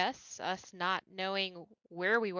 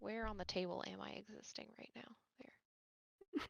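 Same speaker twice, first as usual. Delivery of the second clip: hushed aside to the table; whisper; 13 dB quieter than usual. Real, not acted. Player whispering a quick aside to the table, hushed. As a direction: whisper it under your breath.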